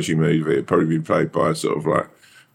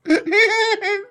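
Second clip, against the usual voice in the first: venomous cackle. Retold or imitated. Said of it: "the most venomous impression of me".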